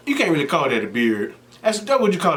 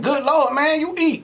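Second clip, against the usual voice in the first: much higher, laughing mock gasp; mock amazed Zach; 13 semitones higher, slower than usual. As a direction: play him stunned by how much she eats. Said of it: "He looking at her like".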